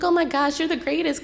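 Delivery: high-pitched gushing gratitude. Retold or imitated. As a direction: gush at him, way up high. Gushing thanks to the man.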